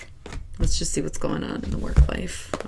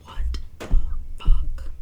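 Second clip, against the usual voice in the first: whispered disbelief; slower than usual. Retold or imitated. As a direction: whisper it, then punch the last word. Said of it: "I'm just like".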